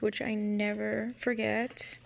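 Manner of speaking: usual speaking voice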